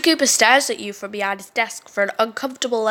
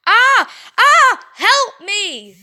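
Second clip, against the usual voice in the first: terrified screams; 9 semitones higher, 5 dB louder, much slower than usual. Acted. Ben mauled by bear.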